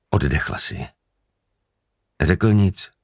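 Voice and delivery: plain reading voice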